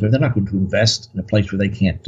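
ordinary delivery

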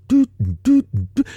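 mimicked music beat. Speaker demonstrates the same beat tempo, high mock-music toots. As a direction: punch out evenly spaced high toots. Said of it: "has that same beat tempo".

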